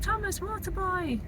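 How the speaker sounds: high, sing-song waitress voice; imitating the waitress. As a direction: chirp it like a friendly waitress. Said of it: "there's a waitress who's like".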